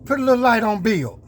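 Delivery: high, loud showman hype; hype intro. Real, not acted. Vlogger hyping his own truck video, loud and high. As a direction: announce yourself like a star entrance.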